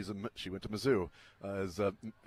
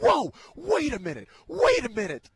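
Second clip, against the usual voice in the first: much higher and louder, a startled Mizzou fan; imitating a fan pulling him up short; startled objection; 8 dB louder than usual. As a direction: throw up a mock alarm at the insult. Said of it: "She's like".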